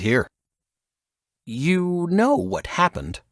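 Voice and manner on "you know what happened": grumbling. A narrator's reading. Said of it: "Silas grumbled".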